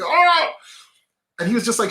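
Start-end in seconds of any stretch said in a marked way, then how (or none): 0.0-0.5 high, loud cry of frustration; frustrated yell. Retold or imitated. Stressed man yelling at God in frustration, high and loud. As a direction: let out a loud exasperated shout.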